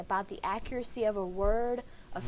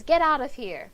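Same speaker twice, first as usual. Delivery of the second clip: sudden loud shout, higher; 4 semitones higher, 8 dB louder than usual. Shown as a demonstration. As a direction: yell it to throw someone out. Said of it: "I'm probably gonna shout that".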